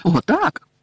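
high, loud emphatic yes; fierce. A narrator's reading. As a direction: vehement little elf agreeing. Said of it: "люто закивала головою Вінкі".

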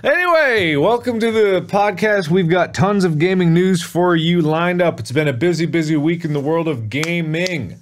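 high, loud announcer energy; podcast host intro. Acted. Host opening the gaming news podcast, loud announcer delivery. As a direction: launch the show with big presenter projection.

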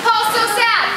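high mock-dramatic call; sad cue called out. Teacher stopping the parachute party, high dramatic call. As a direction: call it out high to stop the game. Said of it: "when I say, "Paul's sad".

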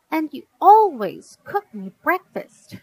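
put-on put-out little girl insisting; indignant protest. A narrator's reading. Girl reminding dad about breakfast, indignant protest.

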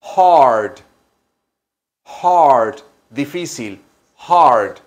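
hard, loud with a breathy h; hard, stressed and clear each time; teaching hard. Shown as a demonstration. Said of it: "se dice igual que difícil". (enseñando hard con h aspirada)

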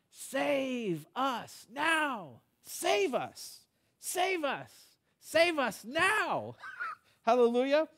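very high, drawn-out repeated cries; crying out. Retold or imitated. Preacher voicing little kids in the temple, crying out. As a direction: imitate children calling for rescue, long and high. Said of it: "they're crying out".

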